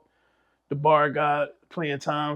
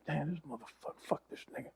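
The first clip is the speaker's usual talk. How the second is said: much softer, nearly whispered cursing; under-breath curse; 2 semitones lower, 19 dB quieter, faster than usual. Retold or imitated. Privately cursing the mentor who just schooled him, hushed. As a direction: mutter it to yourself, annoyed. Muttered reaction to mentor's advice.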